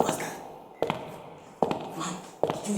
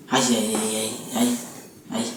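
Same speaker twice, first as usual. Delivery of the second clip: lower, hammed-up despairing wail; mock wail; 7 semitones lower, 10 dB louder than usual. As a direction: wail like it's the end of the world. Son wails in despair.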